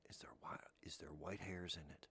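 furtive whisper; a whispered worry at the dinner table; whispered thought. Pretend. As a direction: whisper it to yourself, suspicious. Imagined worried thought, whispered.

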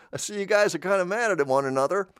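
mock neutral onlooker. Neutral American to guys mad at one another, mock noncommittal voice. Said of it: "can I be neutral?".

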